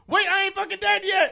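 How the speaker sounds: imitating a dying game character calling the player back; mock alien yell. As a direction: holler it as a not-quite-dead alien. Dying alien calls again.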